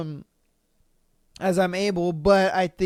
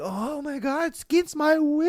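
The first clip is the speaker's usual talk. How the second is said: mock distress; 8 semitones higher than usual. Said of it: "it's like".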